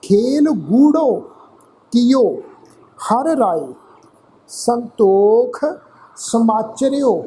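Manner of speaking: slow, drawn-out chanted reading, each word clear; careful recitation. Shown as a demonstration. Teacher repeats the verse for the congregation, slow and careful. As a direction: recite it slowly so every word is heard. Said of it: "ਫਿਰ ਇਹਨੂੰ ਧਿਆਨ ਨਾਲ ਸੁਣੋ ਜੀ".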